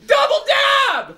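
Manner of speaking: high, slow shouted hype; hype call. Real, not acted. Streamer calls for the dab, a slow high shout. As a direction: drag out the call to pump the group.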